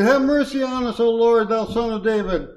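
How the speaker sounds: high-pitched acted plea for mercy. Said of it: "they cried the more".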